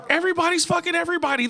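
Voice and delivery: high, fast, excited rush; excited rant. Real, not acted. Comic telling the crowd about fan fiction, high fast excited rush. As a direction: blurt it out high and quick.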